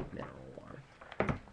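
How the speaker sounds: breathy whisper of "Mineral water", no voice at all; just breathe "Mineral water" under your breath. Shown as a demonstration. Repeats the product name whispered.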